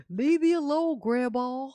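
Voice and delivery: put-on grumpy sleepy teen. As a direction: imitate a boy who won't get up. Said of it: "he was like".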